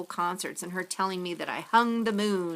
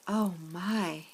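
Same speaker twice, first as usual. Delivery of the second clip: usual speaking voice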